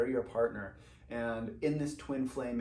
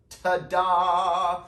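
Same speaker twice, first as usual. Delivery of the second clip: loud, high showy ta-da; mock ta-da; 6 semitones higher, 12 dB louder than usual. Voicing the viewer meeting their partner, loud showy ta-da. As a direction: announce it like a magic reveal. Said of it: "And you're like".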